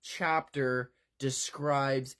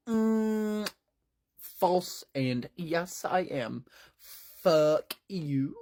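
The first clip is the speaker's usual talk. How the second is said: smug defiance; 5 semitones higher, much slower than usual. Acted. Kent defying Tim.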